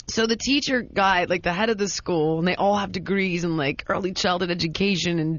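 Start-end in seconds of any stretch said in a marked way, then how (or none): none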